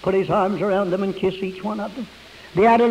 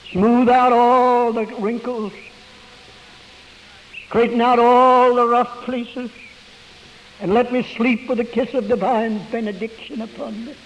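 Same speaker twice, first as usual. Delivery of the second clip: fervent prayer; 4 semitones higher than usual. Acted. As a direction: stretch each plea out to the Lord. Preacher prays aloud.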